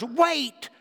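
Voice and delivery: high, stunned disbelief at the tidy room; shocked parent. Pretend. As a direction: ask it like you can't believe your eyes, voice jumping up. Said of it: "you look as if you're somewhat shocked".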